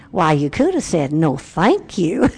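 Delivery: chiding, drawn-out Southern US politeness; very Southern drawl. Retold or imitated. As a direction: correct someone in a slow Southern accent. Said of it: "in her very Southern drawl".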